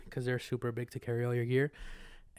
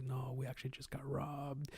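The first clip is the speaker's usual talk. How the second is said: hushed confiding whisper; 12 dB quieter than usual. Retold or imitated. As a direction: whisper it like a secret. Owners tell him of robbery.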